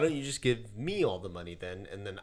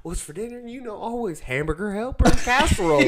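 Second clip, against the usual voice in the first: higher, louder mom voice; chirpy mother listing dinners; 11 semitones higher, 12 dB louder than usual. Retold or imitated. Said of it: "I just did your mom's voice".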